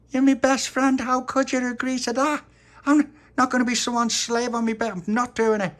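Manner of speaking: high, loud outraged protest; indignant child Tony. Acted. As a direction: wail it as a betrayed little boy. Tony protests to his best friend.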